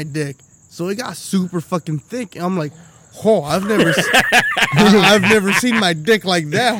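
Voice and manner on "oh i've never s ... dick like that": louder, astonished exclaim with a laugh; amazed. Retold or imitated. Shocked at his own reflection, loud and amazed. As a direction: marvel in shock.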